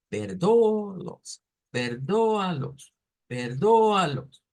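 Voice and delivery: slow "perdóalos" with the A punched loud; heavy stress on A. Shown as a demonstration. Teacher drilling the stressed A of "perdóalos". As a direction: drag out "perdóalos" and hit the A hard. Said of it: "con la fuerza de voz en esa parte".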